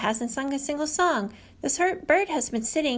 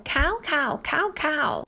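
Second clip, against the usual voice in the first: crow caw; 2 semitones higher, slower than usual. Retold or imitated. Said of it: "Crow calls out".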